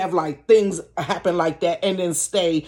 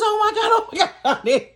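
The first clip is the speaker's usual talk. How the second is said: high mock-panicked old woman, laughing through it; imitating the neighbor, with a laugh; panicky old lady; 12 semitones higher than usual. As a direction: fret like a scared old lady, higher.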